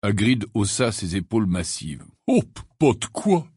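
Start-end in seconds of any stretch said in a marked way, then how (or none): 2.3-3.5 higher, brusque brush-off; abrupt. A narrator's reading. Hagrid brushes off the apology, abrupt. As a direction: cut it short, gruffly acted. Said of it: "dit-il d'un ton abrupt".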